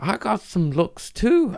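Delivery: high-pitched attempt at an Australian accent; clumsy, squeaky-high Aussie imitation; bad Australian accent. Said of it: "I don't know how to sound Australian".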